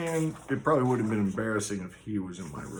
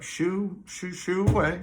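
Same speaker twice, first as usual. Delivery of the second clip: higher, lightly voiced shooing-away; mock shooing; 7 semitones higher, slower than usual. The giant in the dream waves off the angel of death: mock shooing. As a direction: flick the words out like shooing a cat. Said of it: "but he's like".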